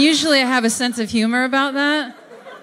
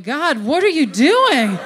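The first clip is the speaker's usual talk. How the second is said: alarmed angels; 8 semitones higher than usual. Retold or imitated. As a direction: protest in mock alarm. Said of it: "the angels are standing back going".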